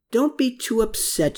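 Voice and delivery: usual speaking voice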